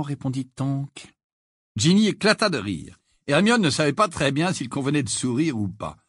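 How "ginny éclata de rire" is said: ordinary delivery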